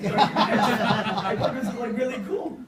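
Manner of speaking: chuckling at how clueless he was; laughing. Real, not acted. Telling the audience about the coup, laughing through it. Laughing at his own oblivion.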